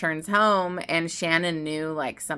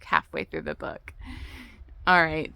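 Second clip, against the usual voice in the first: normal talking voice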